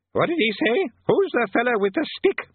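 put-on high, loud, deaf old man; doddery old wizard. A narrator's reading. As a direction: shout like a deaf old codger. Deaf old Arch-Chancellor.

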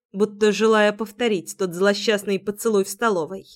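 plain reading voice

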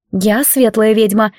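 ordinary delivery

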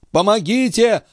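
very high, drawn desperate cries; crying for help. A narrator's reading. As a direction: cry out high for help, without real volume. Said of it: "кричал старик". (отчаянно зовёт на помощь высоким голосом)

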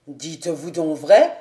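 the captain crying out at her words; exclaiming. Acted. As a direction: burst out, low and loud. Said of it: "s'écria Didier".

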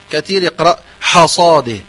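usual speaking voice

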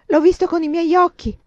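usual speaking voice

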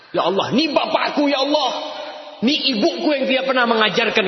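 high, pleading accusation; accusing child. Acted. Child in the hereafter accusing parents before God, high pleading. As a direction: cry out against the parents.

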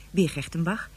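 normal talking voice

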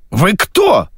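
sharp, high-pitched bark. A narrator's reading. Man demands to know who the guest is, barking. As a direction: snap the question out abruptly. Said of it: "рявкнул собеседник".

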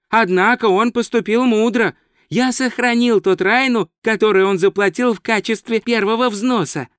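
high, earnest tourist voice; naive tourist, approving. Acted. Tourist explains landlord's payment to wizard, high and approving.

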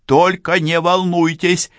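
high, loud, slow call; shouting. A narrator's reading. Policewoman calling to the criminal to stay calm, shouting. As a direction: shout slowly so he hears you through the door. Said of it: "крикнула Францискович преступнику".

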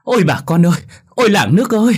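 high, loud wailing lament; wailing cry. Acted. Butcher's wife wailing to the villagers over the body, high loud lament. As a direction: howl it out to the whole village. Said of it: "mà khóc thét".

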